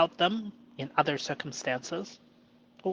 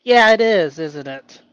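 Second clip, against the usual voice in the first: playful loud agreement; 11 dB louder than usual. Pretend. Answering his inner voice, a loud playful yes. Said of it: "agree with inner voice".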